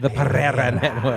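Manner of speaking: slow grand announcer read. Pretend. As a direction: announce it like a TV network ident. Announcing network name.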